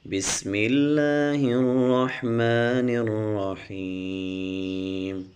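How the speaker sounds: slow, measured tajweed recitation; Quran recitation. Shown as a demonstration. Teacher spelling Quran syllables for students, slow chant. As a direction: chant each syllable slowly and clearly.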